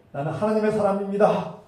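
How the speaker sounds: high, building to a loud cry; proclaiming shout. Retold or imitated. Saul boasting before God, shouted proclamation. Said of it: "외쳤지만".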